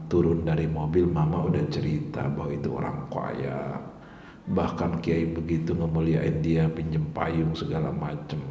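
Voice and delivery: put-on an old cleric quietly admitting his fault to the teacher; quiet confession. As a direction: confess it humbly, hushed and low.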